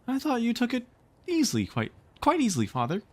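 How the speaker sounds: put-on high, puzzled girl's voice; puzzled little girl. Wendy questions her father, high and puzzled. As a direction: wonder it aloud like a small child. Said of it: "Wendy was quite puzzled".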